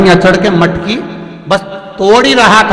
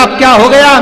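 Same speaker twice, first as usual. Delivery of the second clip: loud, high fed-up cry; not again; husband woken again, fed up; exasperated; 8 semitones higher, 7 dB louder than usual. Acted.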